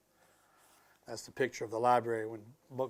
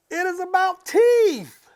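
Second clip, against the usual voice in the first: put-on much higher, louder and slow, in a child's voice; a girl shrieking with delight; excited scream; 12 dB louder, slower than usual. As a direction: squeal it like a thrilled little girl. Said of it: "She screamed".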